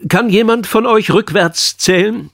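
deutlich höhere, gespielte Lehrerstimme; fragender Lehrer. A narrator's reading. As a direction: stell die Frage hoch angehoben an die Klasse. Vertretungslehrer fragt die Klasse. (Lehrerfrage mit angehobener Stimme)

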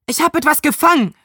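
calling out a catch; excited call. A narrator's reading. Boy calls out to friends that he hooked something, excited and fast. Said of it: "rief ich".

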